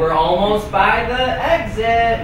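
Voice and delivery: drawn-out, very slow warning; stalling mock departure. Correspondent and agent fake leaving the show, stalling slow announcement. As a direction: drag every word, begging to be called back.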